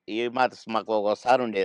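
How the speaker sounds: ordinary talk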